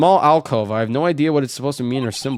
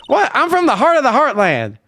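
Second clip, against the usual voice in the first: high, proud Southern twang; country accent; 6 dB louder than usual. Acted. Photographer answering the old lady, high proud country accent. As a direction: declare your roots in a country accent. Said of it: "Country accent".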